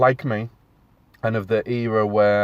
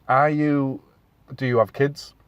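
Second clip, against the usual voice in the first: normal talking voice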